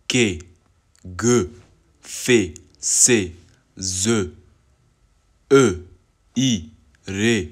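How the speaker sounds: loud, separately sounded consonant names; consonant demo. Shown as a demonstration. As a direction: call out each consonant firmly, one at a time. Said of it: "Les consonnes sont". (consonnes prononcées fort, une à une)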